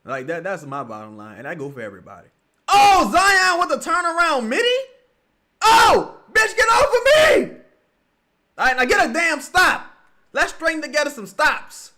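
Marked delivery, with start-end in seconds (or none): 2.7-4.7 sudden loud excited call at the TV; real excited outburst; excited yell. Real, not acted. Live game reaction. 5.6-7.6 high, loud yell at the screen; real shouted outburst; furious shout. Real, not acted. Yelling at the game. 8.6-11.9 loud, raised coaching yell; real loud encouragement; loud urging. Real, not acted. Urging team on TV.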